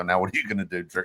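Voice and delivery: low, quiet mocking taunt; a sly teasing jab, kept low. Friend gloating at the hurt guy, low mock taunt. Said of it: "and I'd leave and just go".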